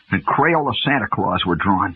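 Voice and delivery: ordinary delivery, nothing marked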